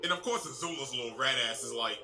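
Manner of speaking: ordinary delivery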